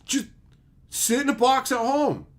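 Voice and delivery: high, slow put-on voice; sarcastic mimic. Mocking the advice to stay home, high and slow.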